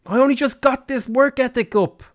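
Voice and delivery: high-pitched indignant complaint; exasperated protest. Real, not acted. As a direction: protest in aggrieved disbelief. Protesting game blizzard disaster.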